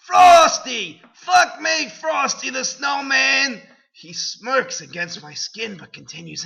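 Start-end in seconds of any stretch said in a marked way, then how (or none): 0.1-3.6 very high, loud mock screaming; over-the-top high scream; hammed-up climactic scream. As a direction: shriek it like an overacted heroine.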